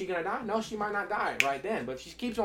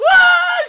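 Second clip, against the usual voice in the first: imitated child cry; 15 dB louder than usual. Daughter cries in retold scene.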